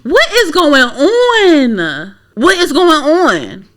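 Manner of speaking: high, long drawn-out wail of disbelief; exasperated disbelief. Real, not acted. Reacting to the Oscars uproar, drawn-out exasperation. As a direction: stretch it out slowly and high.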